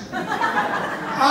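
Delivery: a short burst of real laughter; laughing. Real, not acted. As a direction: laugh at your own joke. Laughs at his own joke.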